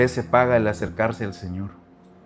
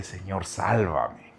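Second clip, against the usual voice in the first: soft slow plea; 7 semitones lower, 7 dB quieter, much slower than usual. Shown as a demonstration. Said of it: "en gritarle".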